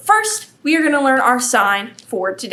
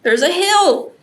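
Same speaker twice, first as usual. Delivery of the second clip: usual speaking voice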